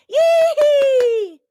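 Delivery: high shouted yee-hee squeal; hammed-up gleeful whoop; shrill cartoon cheer; mock squeal. Mocking cheering.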